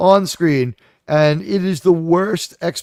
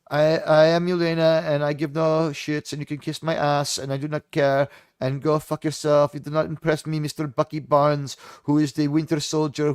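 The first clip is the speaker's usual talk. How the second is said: Yelena; 2 semitones lower, 3 dB quieter than usual. Acted. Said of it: "That's how she talks".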